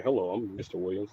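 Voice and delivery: formal parent voice. Retold or imitated. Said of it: "when I talk to parents".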